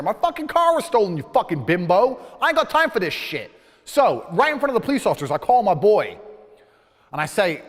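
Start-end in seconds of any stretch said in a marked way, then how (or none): 0.0-3.5 high, loud furious rant; shouting at the officer over his stolen car; angry shout. Retold or imitated. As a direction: yell it in her face. Insulting the officer.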